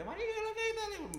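mock wild high-pitched greeting; crazy outburst. Imitating a friend who suddenly goes all crazy, wild outburst. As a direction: burst out high like a madman. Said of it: "está así todo loco y de repente".

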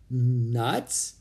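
rising, questioning repeat; puzzled echo. A narrator's reading. Priscilla repeats the slang she doesn't know, puzzled. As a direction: try out an unfamiliar word. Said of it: "repeated Priscilla".

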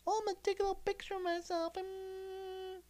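mock her in a squeaky high voice; mocking a girl who is secretly filming him, squeaky and high; mocking girly voice. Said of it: "you know, the little".

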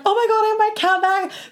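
high, loud squeal of delight; thrilled outburst. Retold or imitated. Retelling getting her account back, squealing with joy. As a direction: burst out like you just won it back.

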